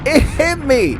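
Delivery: high-pitched, stunned announcement; theatrical dawning horror; dramatic realization. Retold or imitated. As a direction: proclaim it high, falling at the end.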